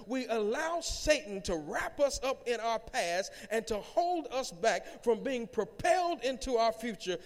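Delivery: full-force preaching. Real, not acted. Pastor urging congregation up the emotional ladder, full-force preaching. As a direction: build a driving sermon, drawing out the final words.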